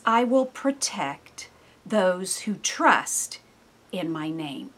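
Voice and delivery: slow, solemn reading of the verse; scripture reading. Acted. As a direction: read it reverently, slowly. Reads the psalm slowly.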